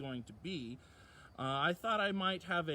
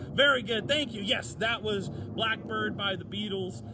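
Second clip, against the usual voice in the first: excited; 5 semitones higher, 8 dB louder than usual. Real, not acted. Praising correct guess loudly.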